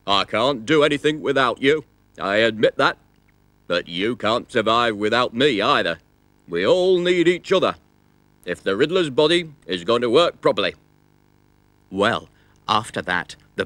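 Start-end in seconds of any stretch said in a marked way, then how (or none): 0.1-6.0 higher, louder pleading stomach voice; talking stomach. Acted. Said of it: "said the riddler's stomach". 6.5-7.8 higher stomach voice; talking stomach. Acted. Stomach states the moral. 8.4-10.7 higher, louder pleading stomach voice; talking stomach. Acted. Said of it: "said the riddler's stomach".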